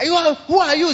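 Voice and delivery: high shocked shout; startled demand. Acted. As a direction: demand who's there, shaken. Saul struck, challenging Jesus.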